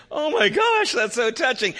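very high, loud mock gush; imitating moved onlookers, pitch way up; gushing nurses. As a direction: exclaim it like overcome nurses. Nurses moved to tears, retold.

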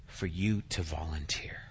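whisper. Acted. Preacher's hushed punchline.

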